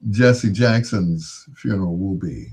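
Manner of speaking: normal talking voice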